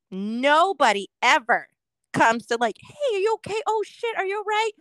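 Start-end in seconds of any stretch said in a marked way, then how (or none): 2.8-4.7 high, soft caring voice; caring bystander. Retold or imitated. Woman imagining a kind stranger checking on her, high and soft. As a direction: sound like a concerned stranger.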